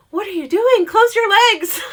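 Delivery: high and loud, laughing through it; laughing scold. Retold or imitated. Scolding her friend about the guy, high laughing scold. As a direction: tease her friend while cracking up.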